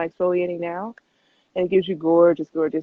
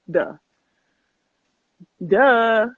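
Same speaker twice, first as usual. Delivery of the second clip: a loud, teasing "Duh."; tossing off the obvious with a grin; playful duh; 3 semitones higher, 5 dB louder than usual. Real, not acted. To fans about Serena being smart, a loud playful duh.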